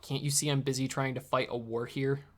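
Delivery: ordinary delivery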